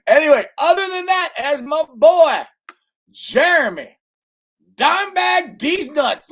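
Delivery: high, loud, drawn-out hype voice; hyped shout-out. Real, not acted. Host building to his friend's catchphrase, loud hyped shout-out. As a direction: belt out the names like a hype man.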